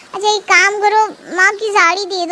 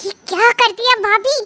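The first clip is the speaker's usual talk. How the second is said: put-on higher, upset young sister-in-law; whiny higher-pitched protest; complaining young girl; 6 semitones higher than usual. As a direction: scold her for hitting him. Lily complains she hit boyfriend.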